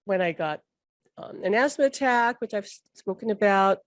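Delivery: ordinary talk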